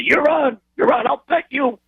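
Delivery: very high, eager challenge; cocky bet acceptance. Retold or imitated. Husband taking his wife's bet, cocky and eager.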